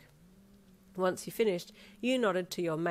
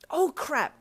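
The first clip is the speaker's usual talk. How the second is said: high, loud exclamation; alarmed gasp; 10 semitones higher, 7 dB louder than usual. Acted. Noticing the time, gasping loudly in alarm. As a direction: burst out on realising you are late. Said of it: "you gasped".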